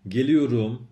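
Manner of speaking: گلیوروم spoken loud and crisp for students; Turkish model word. Shown as a demonstration. Teacher models گلیوروم for Persian learners, clear and loud.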